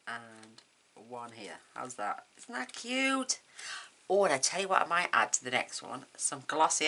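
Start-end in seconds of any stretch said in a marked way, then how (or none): 2.4-3.4 high, slow, delighted coo. Real, not acted. Showing viewers the finished card: slow, high, cooing praise.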